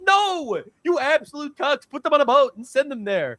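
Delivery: high-pitched incredulous rant; exasperated. Acted. Comedian scolding Germany for giving in, high exasperated rant.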